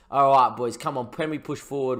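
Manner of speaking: usual speaking voice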